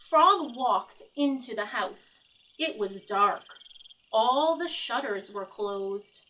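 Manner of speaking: lively picture-book read-aloud; reading to children. A narrator's reading. Teacher reading Frog and Toad to the class, lively storytelling. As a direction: read it like story time for kids.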